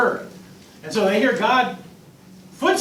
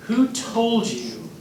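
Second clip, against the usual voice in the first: slow probing question; 6 semitones lower, 4 dB quieter than usual. Retold or imitated. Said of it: "God's response is so fascinating".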